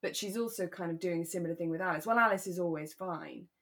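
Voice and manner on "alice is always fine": normal talking voice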